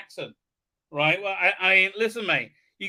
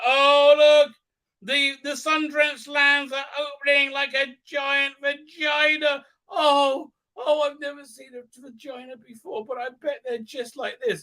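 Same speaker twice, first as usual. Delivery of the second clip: hammed-up awe; 7 semitones higher, 3 dB louder than usual. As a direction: gasp and marvel in a high put-on voice. Acts awe at opening lands.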